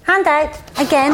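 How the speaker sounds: a hint of a stern schoolteacher; playful scolding, hand out for the cane; co-host plays teacher to Richard, mock stern telling-off.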